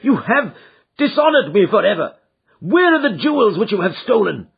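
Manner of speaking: loud, high, furious accusation; enraged. Acted. Father raging at son over the ruined coronet. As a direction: keep the rage boiling. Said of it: "beside myself with rage".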